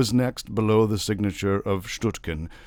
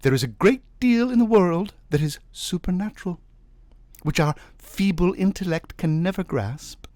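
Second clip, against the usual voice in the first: higher, earnest voice; mystical wife; 9 semitones higher, 3 dB louder than usual. A narrator's reading. Wife explaining spirits to Navagin, earnest and high. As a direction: convince him of spirits.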